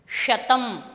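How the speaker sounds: ordinary talk, nothing marked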